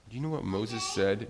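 usual speaking voice

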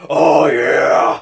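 loud, low gravelly growl; gravelly Batman voice. Pretend. Co-host imagining Batman in bed, mock gravelly voice. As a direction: growl it like a hammed-up superhero. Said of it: "imagine that with his gravelly voice".

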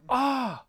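high, loud cartoon exclamation; one sudden dubbed-anime "Ah!"; Speed Racer. Guest doing a one-syllable cartoon impersonation, high loud gasp-cry. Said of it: "I can do a Speed Racer impersonation".

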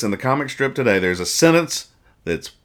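normal talking voice

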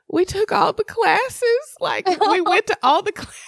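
tearful pleading. Retold or imitated. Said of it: "tears start falling".

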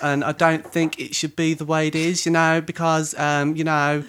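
a hint of Brummie in the Essex voice; essex accent. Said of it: "Sounds a bit brummy".

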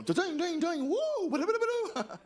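high, loud sing-song ding-ding sounds; excited scatting. Real, not acted. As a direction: burst into gleeful nonsense sounds. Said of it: "I'm getting excited about this".

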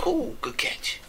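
soft unvoiced whisper; hushed aside. Real, not acted. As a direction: breathe it out in a whisper.

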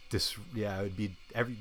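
ordinary talk, nothing marked

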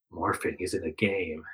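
low, slow and quiet warning; dark. A narrator's reading. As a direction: say it grimly, almost under breath. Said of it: "Jake says darkly".

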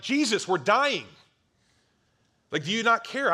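high, loud, desperate cry; panicked yelling. Retold or imitated. Disciples yelling at sleeping Jesus, panicked shout. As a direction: yell it like the boat is sinking. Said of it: "They're yelling".

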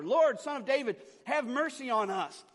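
high, loud cry for mercy. A narrator's reading. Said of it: "they only shouted louder".

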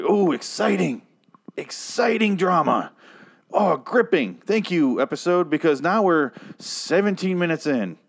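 high-pitched, drawn-out sarcastic gushing; mock excitement. Reviewer mocks a dull episode's fake-gun scene, sarcastically thrilled. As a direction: gush sarcastically, pitched well above normal.

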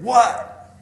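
loud, high imitated kid's "what"; sulky retort. Six-year-old son answering dad back, sulky retort. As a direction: throw it back like a stung child.

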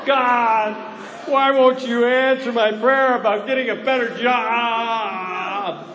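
loud, high, exasperated complaint; complaining shout to God. Acted. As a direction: cry out to God in frustration. Voicing a single's complaint prayer.

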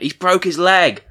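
high, loud and slow, the alarmed first spotter; calling out in shock as the fan who saw it first. Retold or imitated. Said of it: "I went".

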